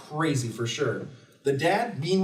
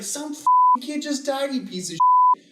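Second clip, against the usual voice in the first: high, loud mock yell; hammed-up angry scold; mock outrage; 9 semitones higher, 5 dB louder than usual. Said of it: "And it's like".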